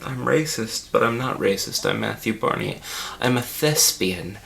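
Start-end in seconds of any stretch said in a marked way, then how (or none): none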